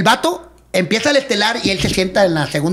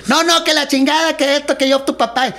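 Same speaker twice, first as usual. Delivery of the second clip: stubborn father; 7 semitones higher than usual. Retold or imitated. Father refuses the paperwork again.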